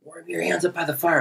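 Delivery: high, hushed call-over; half-whispered mock invitation; beckoning. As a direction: imitate strangers softly calling someone in. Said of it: "flagging me in".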